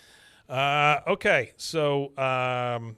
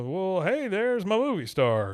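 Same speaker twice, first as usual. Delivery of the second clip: Hank voice; 7 semitones higher, 4 dB louder, slower than usual. Retold or imitated. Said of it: "Hank comes in and he's like".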